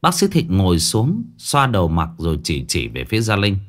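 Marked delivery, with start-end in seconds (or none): none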